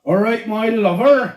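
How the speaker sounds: drawn-out West Country greeting, Bristol style; Bristol accent. Retold or imitated. Imitating a Bristol girlfriend's greeting, Bristol accent. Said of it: "I used to see a girl from Bristol".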